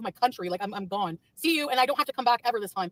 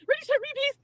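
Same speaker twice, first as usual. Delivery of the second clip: much higher, thin cartoon squeak; squeaky mock voice; 14 semitones higher than usual. Streamer mocks her critics in a squeaky cartoon voice. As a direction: squeak it out in a mocking toy voice.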